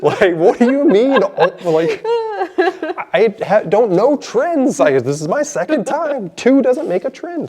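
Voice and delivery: high, laughing exasperation; giggling disbelief; laughing protest. Pretend.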